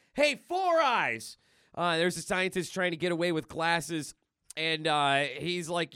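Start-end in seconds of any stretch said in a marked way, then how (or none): 0.1-1.4 high, loud, drawn-out holler; taunting call-out. Acted. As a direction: call across the room to a victim. Deadpool calling to scientist.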